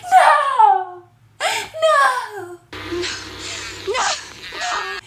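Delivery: high shrieked no, half laughing; hammed-up horrified scream; mock screaming no. Blondie screaming as she stabs the killer, hammed up. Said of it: "she's like".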